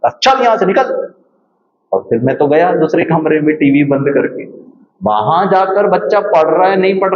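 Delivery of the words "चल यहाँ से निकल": mother scolding. Retold or imitated. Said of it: "डांटा, धमकाया".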